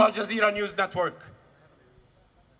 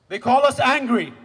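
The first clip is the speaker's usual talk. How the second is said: loud, higher burst; rising anger; 4 semitones higher, 8 dB louder than usual. Real, not acted. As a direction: throw the accusation out hard. Rallying against the label.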